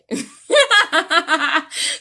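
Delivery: a high, loud burst of laughter. Real, not acted. Reader cracking up after acting out the partner's speech, loud laugh. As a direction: let a big laugh burst out. Laughs at her own presentation.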